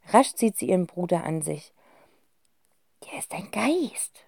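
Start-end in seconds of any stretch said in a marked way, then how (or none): 3.0-4.2 scared whisper. Acted. Kind flüstert ängstlich.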